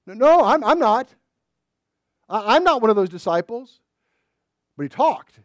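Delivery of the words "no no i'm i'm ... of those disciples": high, loud, frantic no; panicked denial. Acted. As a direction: deny it in a rush of fear. Peter denies in fear.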